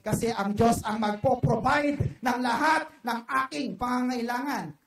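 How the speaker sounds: loud full-force sermon delivery; fervent preaching. Real, not acted. Pastor to congregation, loud testimony of God's provision.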